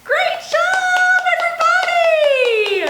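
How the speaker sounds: big happy storytime praise; excited praise. Real, not acted. Praising kids after the rhyme.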